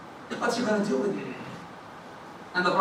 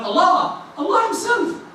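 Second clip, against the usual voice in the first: high, ringing emphasis on Allah; emphatic answer; 10 semitones higher than usual. Acted. Prophet answering the Bedouin, emphatic and high. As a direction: lift Allah Himself up high.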